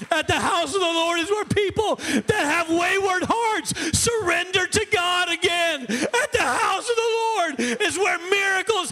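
high, shouted revival preaching; rally the church at the top of your voice; full-force preaching. Real, not acted. Preacher urging church to the house of the Lord, shouting.

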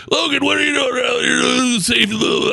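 high-pitched garbled shouting in imitation; mock coach yelling. Coach berating a player after a failed tackle, mock yelling. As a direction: imitate an irate coach hollering from afar. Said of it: "The coach is yelling at me".

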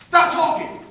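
high, very loud order; exasperated parent. Acted. Parent to a chattering child, exasperated shout.

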